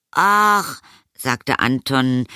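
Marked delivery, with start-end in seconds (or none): 0.1-0.8 put-on high, drawn-out reluctant boy; whiny boy. A narrator's reading. Anton answers his mother, whining reluctance.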